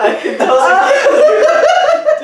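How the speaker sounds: very high, very slow, laughing hard; laughing squeal. Real, not acted. Host ribbing guest on being flirted with, squealing laughter. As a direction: draw every word out in a laughing squeal. Teasing guest about her cute voice.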